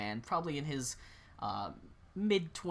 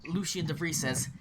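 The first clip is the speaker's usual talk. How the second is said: normal talking voice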